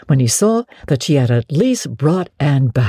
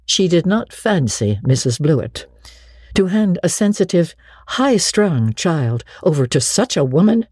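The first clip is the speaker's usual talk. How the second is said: ordinary delivery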